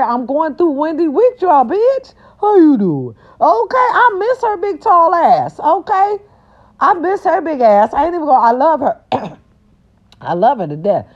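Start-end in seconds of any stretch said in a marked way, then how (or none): 0.0-9.0 squealing fondly. Real, not acted. High squeal missing Wendy.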